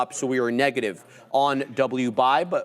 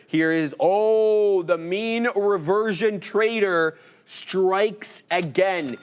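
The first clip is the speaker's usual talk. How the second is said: hyped announcement; 5 semitones higher, much slower than usual. Acted. Announcing a viewer's big win.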